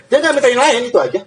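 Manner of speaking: high, mocking echo of his regret; mock whiny. Preacher mocking the man who asked for nothing else, high and whiny.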